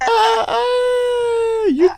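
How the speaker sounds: high, drawn-out outraged cry; shocked shriek. Real, not acted. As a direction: scream in mock shock. Mock outrage at opinion.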